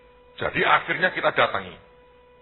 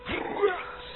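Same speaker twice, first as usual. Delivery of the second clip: long whooshing crash noise; imitated crash; 5 dB quieter than usual. Storyteller shows the rotten roof collapsing, imitated crash noise. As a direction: voice a roof caving in.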